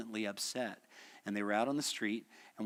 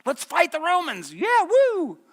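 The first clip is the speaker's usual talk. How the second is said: high, loud rallying whoop; pumped-up battle cry; 12 dB louder, slower than usual. Retold or imitated. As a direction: fire up the crowd for a brawl. Peter eager to fight Romans.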